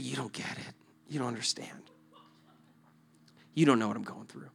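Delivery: soft, downcast murmur; dejected brush-off. Retold or imitated. Struggling man to his encouragers, soft dejected refusal. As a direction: turn help away quietly. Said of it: "And I'm just saying".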